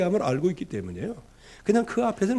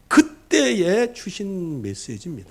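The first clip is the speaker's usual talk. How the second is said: ordinary talk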